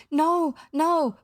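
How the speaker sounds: high, loud, desperate plea; crying out. A narrator's reading. Bravest pirate cowers before his captain, crying out.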